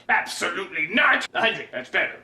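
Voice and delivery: deep, flat, dismissive bargaining; low Wolverine haggle. Acted. Wolverine haggling with the rich buyer, deep and curt. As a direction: drop low and shut the offer down.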